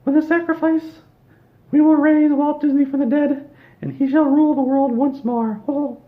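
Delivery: very high, loud squeaky cartoon mouse; villainous falsetto Mickey proclamation, loud and gleeful. Mickey announces raising Walt Disney, in a loud high squeaky voice. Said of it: "and Mickey Mouse said".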